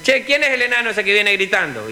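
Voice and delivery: high-pitched puzzled question; bus guard calling out. Acted. Guard asking about the shouter, high calling voice. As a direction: ask it high, calling to those around. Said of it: "se para el guarda y dice".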